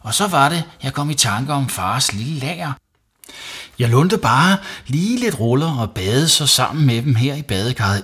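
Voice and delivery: put-on high, eager child voice; pleading boy. Savi explaining the borrowed rolls to mum, pleading. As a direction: explain it all innocently. Boy continuing his excuse.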